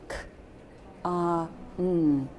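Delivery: "K", "ah", "mm" as isolated speech sounds; separate sounds. Shown as a demonstration. Teacher showing you can say the sounds, "K, ah, mm" separated. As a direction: sound out "K, ah, mm" with gaps between. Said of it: "you can say the sounds".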